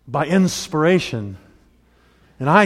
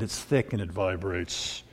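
much lower and slower: "it's thick and it vibrates"; low voice demo; 8 semitones lower, 11 dB quieter than usual. Shown as a demonstration. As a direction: drop the voice deep and drag "thick" to show the low end. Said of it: "A lower noise".

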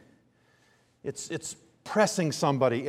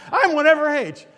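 put-on much higher and louder, a piping child's voice; squeaky kid; 11 semitones higher, 9 dB louder than usual. Son blurting his age at the ticket booth, squeaky high. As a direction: squeak it out like an eager little son. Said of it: "this squeaky noise".

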